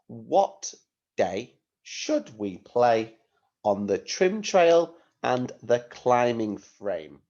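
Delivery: slow, deliberate phrase by phrase; teacher reading out slowly for writing; slow dictation. Shown as a demonstration. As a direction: dictate it carefully with small gaps. Teacher dictating sentence to pupils.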